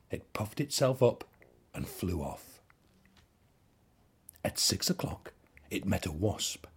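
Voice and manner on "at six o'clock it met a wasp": ordinary delivery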